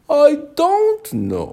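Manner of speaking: very high, slow and odd caterpillar voice. A narrator's reading. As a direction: draw it out in a squeaky creature voice. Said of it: "said the caterpillar".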